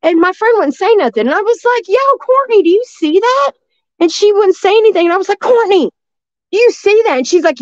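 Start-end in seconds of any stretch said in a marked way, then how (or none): 1.9-3.5 calling out high and loud to a friend; urgent call to Courtney in the dark woods. Retold or imitated. Calling friend about creature. 5.4-7.2 calling out high and loud to a friend; urgent call to Courtney in the dark woods. Retold or imitated.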